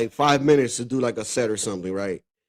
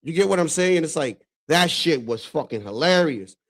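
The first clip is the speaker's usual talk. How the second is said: ordinary delivery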